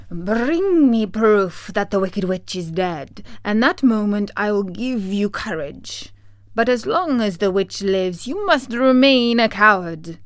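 commanding wizard voice, forceful on key words; imperious, theatrical decree. Acted. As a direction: lay down the terms like a mighty wizard.

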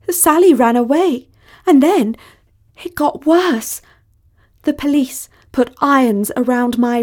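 high, quavering damsel in distress; high-pitched, overwrought pleading; melodramatic plea. A narrator's reading. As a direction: beg to be rescued, theatrically. Melodramatic plea for rescue by voicemail.

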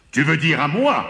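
high-pitched indignant giant's cry. A narrator's reading. A giant calls out from behind the farmer and his wife, crying out. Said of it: "cria une voix derrière eux".

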